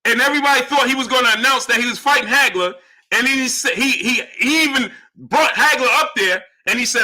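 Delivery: high, heated argumentative voice; exasperated insistence. Real, not acted. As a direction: hammer the point at a stubborn viewer. Heated retelling to prove point.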